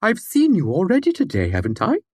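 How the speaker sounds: high voice, drama dropped; eccentric old man. A narrator's reading. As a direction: lose the grand tone, just ask. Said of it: "his voice lost its dramatic resonance".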